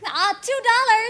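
high, eager bid. Acted. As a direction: jump in eagerly. Timid wife makes first bid.

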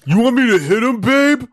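high, loud dopey thug; dopey cartoon bellow. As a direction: bellow eagerly like a dim brute. Second bodyguard voice.

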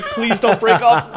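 slow, high, laughing through the words; a giddy, pleading, laugh-broken prayer to the line; laughing plea. Retold or imitated. Angler retelling the fight with a big bass, high laughing plea. Retold begging while fighting fish.

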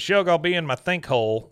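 lightly voiced Dale, pleased with himself; quoting Dale. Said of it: "he says".

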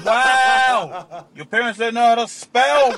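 high, shouted mock disbelief; loud comeback. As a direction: yell the retort for comic outrage.

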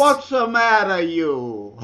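mock New Jersey Italian tough guy; Jersey mob accent. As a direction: bark it like a cartoon Jersey mobster. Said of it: "New Jersey Italiano Mobbish accent".

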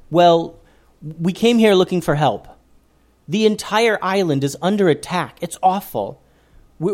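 a hint of a nervous girl asking for help; young girl voice. A narrator's reading. Eleanor pleading to the forest people, lightly childlike.

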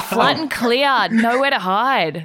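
laughing through the words, slow and loud; laughing tease. Real, not acted. Host teasing a guest about his plain bath, laughing. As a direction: tease him through giggles.

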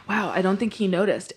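soft sighing mutter to himself; half to himself after a sigh, quiet; sighed aside. Retold or imitated. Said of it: "Matt's like".

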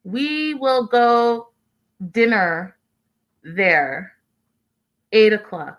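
slow, word-by-word foreigner talk; mock broken English. [name] talking to Ziad, mocking slow broken English. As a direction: space out each word as if for a language learner. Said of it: "still talking in broken English".

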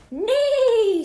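high, loud, long neigh; whinny it out like a horse; big storybook horse call, falling at the end; horse's whinny. A narrator's reading. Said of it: "said a horse".

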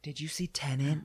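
hushed, confidential whisper. Real, not acted. Asking co-host about a confusing film, whispered. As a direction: whisper the question softly. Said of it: "are you whispering it to me".

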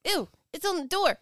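squeamish. Retold or imitated. Acting scared of spider.

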